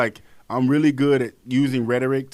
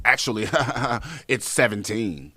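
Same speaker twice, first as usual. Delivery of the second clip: mock commenter; 3 semitones lower, 3 dB quieter than usual. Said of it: "somebody in the comments says".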